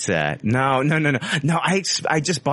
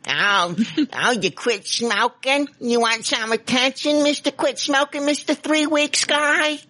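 high, slow sneering mockery of himself; mocking himself. Mocking his own bragging in a high sneer. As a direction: taunt yourself as an attention seeker. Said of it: "That's what I sound like".

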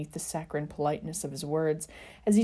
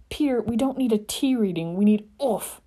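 exasperated; 6 semitones higher, 6 dB louder than usual. A narrator's reading. Exasperated, then kicked in gut.

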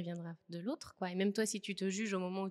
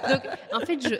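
higher and louder, laughing out the words; real laugh; 5 semitones higher, 15 dB louder than usual. Real, not acted. Amused by her partner's remark, real laughing delivery. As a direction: burst out laughing then carry on.

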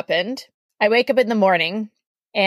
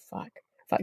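hushed scared curse under the breath; panicked whisper; 12 dB quieter than usual. Retold or imitated. Lying in bed scared of the guy, whispering. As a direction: whisper it so nobody hears.

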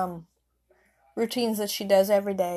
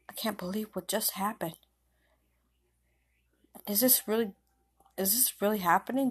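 half-whispered shock; hushed disbelief; 5 dB quieter than usual. A narrator's reading. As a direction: murmur it softly to yourself, stunned. Stunned girl alone after death news.

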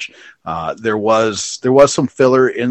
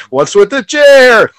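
high, slow, loud wail; hammed-up cry of disbelief; mock bewildered yell; 12 semitones higher, 8 dB louder, slower than usual. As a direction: yell it drawn out, imitating the wrestler. Re-enacting the wrestlers' exchange.

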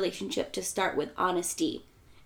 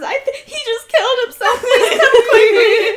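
high, louder fake sobbing plea; mock crying; 11 dB louder than usual. Wife begs police to come, mock tearful wail. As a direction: ham up a tearful call for help. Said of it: "She's crying".

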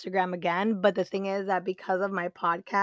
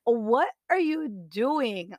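drawn-out, higher-pitched disbelief; incredulous; 4 semitones higher, slower than usual. Retold or imitated. Woman recounting her reaction to an ex's messages, incredulous. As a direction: stretch it out, baffled by the nerve.

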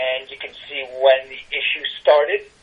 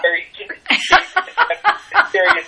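laughing through the words, higher; faster than usual. Real, not acted. Joking to the host about becoming a tarot reader, laughing. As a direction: chuckle your way through the phrase. Laughs at own dating joke.

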